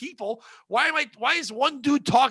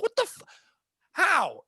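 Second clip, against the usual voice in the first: stunned disbelief; 9 semitones higher than usual. Acted. Reacts to baby suddenly talking.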